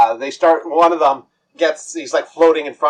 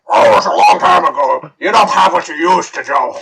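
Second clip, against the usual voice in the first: loud, laughing teasing troll; 3 semitones higher, 6 dB louder than usual. Acted. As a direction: tease Joe through laughter. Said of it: "the troll's like".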